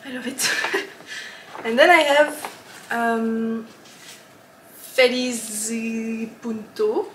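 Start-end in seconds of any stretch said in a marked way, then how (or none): 4.8-7.1 Spanish name with a French accent. Real, not acted. Naming her yarn to viewers, in a French accent. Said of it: "I'm saying that with a French, terrible French accent".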